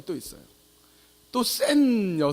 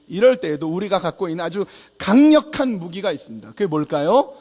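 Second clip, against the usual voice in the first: usual speaking voice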